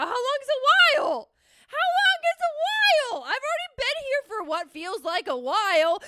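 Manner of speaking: high, loud, hammed-up indignation; exasperated protest. Student ranting about holding chair pose for a while, exasperated. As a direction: protest like a student pushed past her limit. Acted tirade at the teacher's order.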